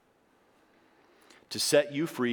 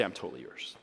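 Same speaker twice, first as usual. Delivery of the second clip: much lower and softer, tender giving in; soft surrender; 10 semitones lower, 9 dB quieter, faster than usual. Pretend. A believer telling God they are his, softly. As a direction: breathe it out as total surrender.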